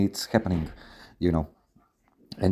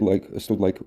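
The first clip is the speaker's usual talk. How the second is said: ordinary delivery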